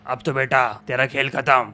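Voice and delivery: deep, low evil criminal voice; menacing villain. Acted. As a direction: growl out threats like a killer. Villain finishes guard.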